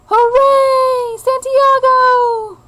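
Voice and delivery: high, loud drawn-out cheer; cheering crowd. Acted. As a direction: cheer him like a whole stadium.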